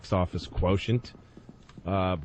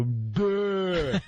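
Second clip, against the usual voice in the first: a higher, dopey duh; dumb athlete; 8 semitones higher than usual. Retold or imitated. Mocking a dumb athlete, dopey grunt. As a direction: play the dim jock. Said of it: "they go".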